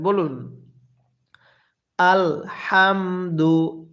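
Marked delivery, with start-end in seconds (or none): 2.0-3.8 very slow আলহামদু with a light puff of air on the H; airy H. Shown as a demonstration. Teacher models আলহামদু for learners to repeat, slow airy H. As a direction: sound out আলহামদু syllable by syllable, breathing out the H. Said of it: "হাটা উচ্চারণের সময় মুখের মধ্যে হালকা বাতাস বের করবেন".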